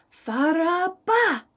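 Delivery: high, drawn-out call; shouted. Acted. Rabbit shouting the rock's name as he flees. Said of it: "he shouted".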